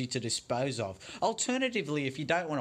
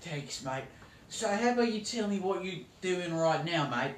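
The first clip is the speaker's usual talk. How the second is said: broad ocker Australian accent played for laughs; hammed-up Aussie accent; 5 semitones higher than usual. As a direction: play a cartoon Aussie TV cook. Parody host continues.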